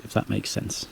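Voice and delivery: breathy whispered afterthought; whispered aside. Real, not acted. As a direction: drop to a whisper, half to yourself. Trailing aside after explanation.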